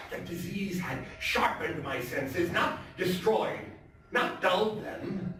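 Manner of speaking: a nervous, wound-up killer confessing in a high, intense voice; tense madman narrator. Acted. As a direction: play the madman insisting he is sane, swinging between hushed and frantic.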